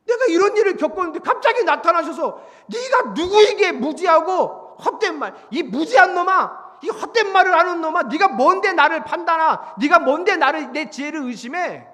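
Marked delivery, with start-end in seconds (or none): 2.7-11.9 loud, raised-pitch dressing-down; acted divine outburst; angry scolding. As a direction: berate an insolent underling. God scolding Job in blunt words.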